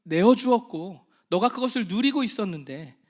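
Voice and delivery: fervent sermon climax fading at the end; impassioned preaching. Acted. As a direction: preach it with full force, building.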